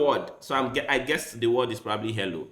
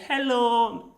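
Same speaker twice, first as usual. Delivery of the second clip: high, drawn-out sung-out greeting; mock friendly hello; 8 semitones higher than usual. Joking how this could mean hello, high greeting. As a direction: call out a long high hello. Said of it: "And I'm saying".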